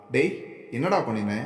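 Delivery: ordinary delivery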